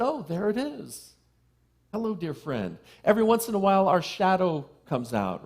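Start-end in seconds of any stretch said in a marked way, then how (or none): 0.0-2.8 gentle greeting. Retold or imitated. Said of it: "I just said".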